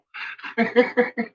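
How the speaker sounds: a high laugh with no voice, all breath; breathy laugh. Real, not acted. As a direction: wheeze at your own dirty joke. Laughing at his crude joke.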